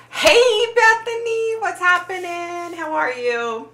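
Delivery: high sing-song hello; cheery greeting. Real, not acted. Streamer welcoming a viewer into the chat, cheery sing-song.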